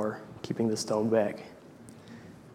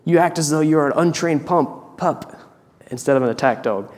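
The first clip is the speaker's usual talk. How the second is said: a hammed-up angry roar, only somewhat louder and higher; thundering voice; 4 semitones higher, 5 dB louder than usual. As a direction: scold the dog with mock fury. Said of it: "His voice thundered in the room".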